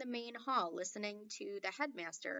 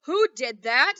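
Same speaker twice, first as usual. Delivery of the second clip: much louder, snapping question; irritable headmaster; 3 semitones higher, 16 dB louder than usual. A narrator's reading. Headmaster demanding who yelped, irritated and loud. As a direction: bark it at the hall. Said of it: "asked Mister Johnson irritably".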